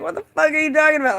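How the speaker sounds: fast, high, bewildered demand; re-enacted confused outburst; retold yelling. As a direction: fire it back high and quick. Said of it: "I was, like, yelling".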